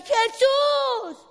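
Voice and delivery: high, loud, long cry; shouting. Acted. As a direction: call out desperately over a crowd. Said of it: "volvía a gritar". (grito fuerte y alargado)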